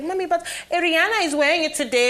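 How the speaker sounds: a hint of a child begging mommy; daughter pleading. Daughter voiced pleading about outfit.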